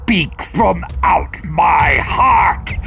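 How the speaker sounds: loud, high, raging command; furious shout. Acted. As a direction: drive the bird out with your voice. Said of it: "I shrieked".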